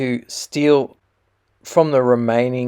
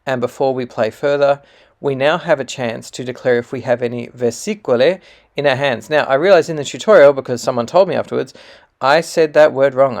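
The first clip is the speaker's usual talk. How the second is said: usual speaking voice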